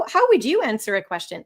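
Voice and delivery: normal talking voice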